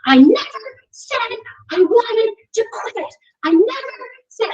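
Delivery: put-on high, loud, stubborn child voice; indignant kid. Son to mom at the hill, high indignant insisting. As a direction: insist like a determined little boy. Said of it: "he goes".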